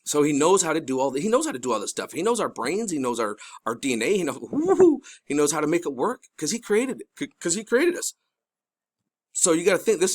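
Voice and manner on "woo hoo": high, loud whooping woo-hoo; excited woo-hoo. Real, not acted. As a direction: let out a high-pitched whoop. Excited aside while explaining.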